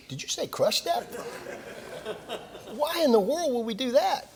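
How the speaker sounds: high, laughing disbelief at what he just heard; a hammed-up "are you serious?"; incredulous laughing. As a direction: pitch it up in mock alarm. Disbelief at crush depth.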